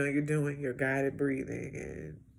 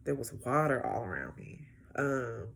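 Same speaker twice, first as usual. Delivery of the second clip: whispered recollection; hushed; 3 semitones lower, 6 dB quieter than usual. Real, not acted. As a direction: drop to a hush as you remember. Quiet recollection of the session.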